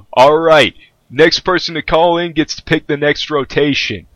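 lively radio host announcing. Acted. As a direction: hype it like a DJ. GM voices radio DJ.

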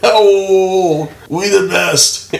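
high, stretched hype-man boast. Acted. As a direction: crow it slowly like a hype man. Catchphrase after [name] talk.

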